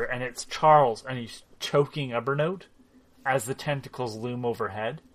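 ordinary talk, nothing marked

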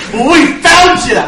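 loud, high gloating taunt; gloating hero. Acted. Luchador taunting the orc after flipping his cape, loud gloat. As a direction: crow it at the cornered enemy, loud. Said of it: "and it's like".

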